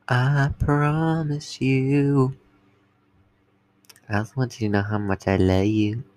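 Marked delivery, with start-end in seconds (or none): 0.1-2.3 slow drawn-out promise. Shown as a demonstration.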